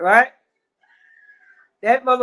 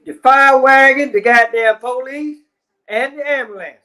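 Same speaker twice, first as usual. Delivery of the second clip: exasperated demand; 2 semitones higher, 8 dB louder than usual. Retold or imitated. Urging a 911 caller's demand.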